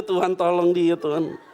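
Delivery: ordinary talk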